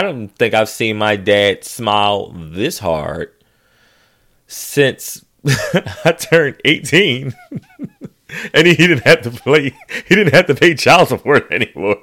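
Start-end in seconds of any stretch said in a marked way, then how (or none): 4.5-12.0 words broken up by real laughter; can't hold the laugh in; laughing while talking. Real, not acted. Laughing while telling joke.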